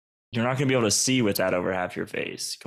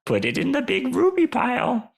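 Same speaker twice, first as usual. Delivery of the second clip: high-pitched creature, dreamy about its hoard; gem-hoarding creature; 6 semitones higher than usual. Acted. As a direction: play a little critter dreaming of treasure.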